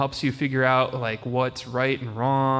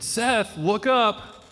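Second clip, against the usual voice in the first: raised, higher call across the yard; imitated yell; 8 semitones higher, much slower than usual. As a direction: call out to get a distracted child's attention. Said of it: "yelled over to you".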